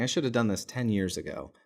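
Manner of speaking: ordinary talk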